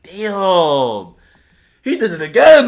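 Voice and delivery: loud, high drawn-out hype; retold roar of astonishment; amazed crowd reaction. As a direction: shout it like a crowd blown away. Retold crowd amazed at second freestyle.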